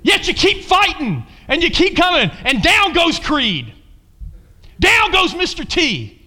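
high, loud, punchy sermon delivery; full-force preaching. Real, not acted. Preacher calling for Rocky-like Christians, fired-up preaching. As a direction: drive it hard like a fight commentary from the pulpit.

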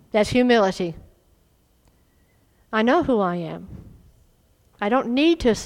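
ordinary talk, nothing marked

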